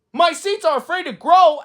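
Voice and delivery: high, loud disbelief; shocked question. Acted. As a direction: ask it in alarm. Toad shocked.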